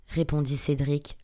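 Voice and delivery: plain reading voice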